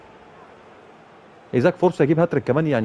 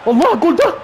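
very high, loud joyful scream; goal celebration; 13 semitones higher, 7 dB louder than usual. Real, not acted. Gamer celebrates his side's goal, ecstatic scream. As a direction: explode with joy at the goal.

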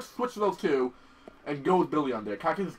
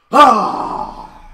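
loud, high drawn-out "ah"; loud exhale cry; 6 semitones higher, 12 dB louder than usual. Real, not acted. Player needing a breather after fight, loud cry. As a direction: let out a big cry of release.

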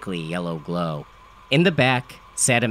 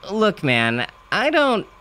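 rising protest; 10 semitones higher, slower than usual. A narrator's reading. Narrator begins, cut off.

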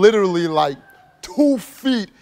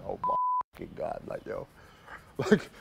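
breathless whispered disbelief; shocked whisper; 3 semitones higher, 12 dB quieter than usual. Retold or imitated. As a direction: whisper it like you can't believe it. Stunned disbelief after the gunshot.

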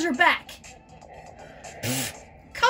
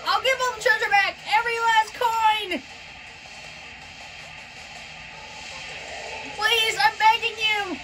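desperate begging; 6 semitones higher than usual. Acted. Villain begging for mercy.

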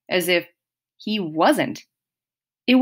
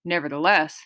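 plain reading voice

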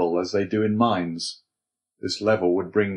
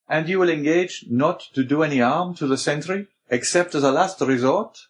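higher-pitched Abbé character voice; Abbé, exacting; 7 semitones higher than usual. A narrator's reading. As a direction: press for a promise, pitch raised.

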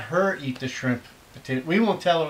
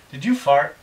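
normal talking voice

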